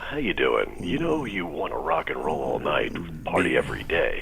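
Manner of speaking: very deep, quiet creepy croon; creepy low voice. Pretend. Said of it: "that's kind of a creepy voice".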